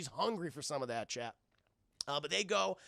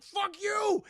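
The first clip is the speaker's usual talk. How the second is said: high, loud retort; defiant shout; 7 dB louder than usual. Retold or imitated. Demona answering the gargoyles before she flees, high defiant yell. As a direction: yell it back in mock defiance.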